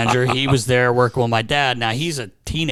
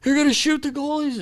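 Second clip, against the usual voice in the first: put-on alarmed kid. Kid telling dad in alarm.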